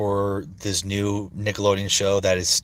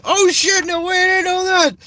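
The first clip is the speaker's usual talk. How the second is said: very high, louder squeaky cartoon boy; squeaky Pico voice; 7 dB louder than usual. Fan reacting, in squeaky high Pico voice. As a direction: squeak it out in shock. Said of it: "my Pico voice is".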